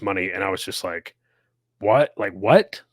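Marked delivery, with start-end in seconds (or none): 1.8-2.8 incredulous. Retold or imitated. Retold own disbelief at pay.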